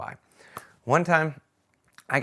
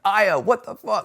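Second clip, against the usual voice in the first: louder, higher and slow, really mad at Aya; angry outburst; 9 semitones higher, 6 dB louder than usual. Retold or imitated. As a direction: demand it from her, furious. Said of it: "the more I was getting mad at her".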